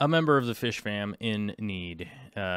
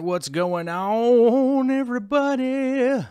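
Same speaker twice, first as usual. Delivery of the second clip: high, slow stretched hype; hyped drawn-out greeting; 11 semitones higher, 6 dB louder, slower than usual. Acted. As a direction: stretch it out like a show host. Drawn-out hyped stream opener.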